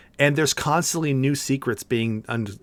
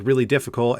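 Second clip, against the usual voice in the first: ordinary delivery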